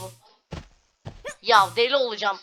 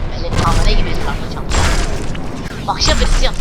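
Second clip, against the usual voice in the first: a louder, fed-up rant; loud complaint; 3 semitones lower, 11 dB louder than usual. Real, not acted. Gamer complaining about being run around, loud and fed-up. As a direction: rant loudly at the game.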